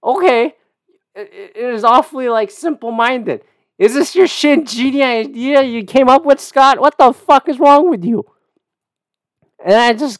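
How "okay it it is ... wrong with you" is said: higher, louder mocking contempt; scornful retort. Mocking Scott Adams's idea to his face, higher and scornful. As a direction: sneer at a simple-minded idea. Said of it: "But I go like".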